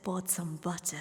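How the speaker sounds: measured, low British recitation; British accent. Acted. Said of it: "you do it in a British accent".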